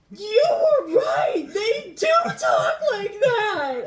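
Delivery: high, loud, drawn-out squeal of amazement, laughing; hammed-up surprise. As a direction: exclaim it slowly in a mock-astonished shriek.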